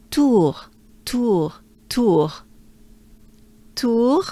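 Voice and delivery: tour with a closed oo and a throaty R; French tour. Shown as a demonstration. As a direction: tour ending in a uvular French R. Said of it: "it ends with the French R".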